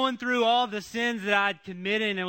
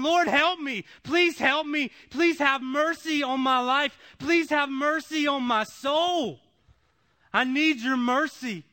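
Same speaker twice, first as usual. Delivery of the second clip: raised, pleading cry to the Lord; pleading prayer; 5 semitones higher, 4 dB louder than usual. Retold or imitated. A broken man crying out to God, pleading and raised. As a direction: beg for mercy, voice lifted high. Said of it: "and I'm saying".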